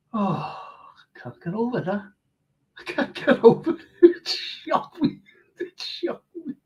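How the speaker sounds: high, whispery, laughing disbelief; overcome. Real, not acted. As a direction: laugh breathlessly in real shock, voice nearly gone. Overcome by a viewer's message.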